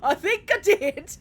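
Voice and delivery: high, loud, slow laughing near tears; laughing harder. Real, not acted. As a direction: crack up while saying it. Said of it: "Did I just salute Jesus?".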